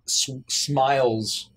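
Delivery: ordinary talk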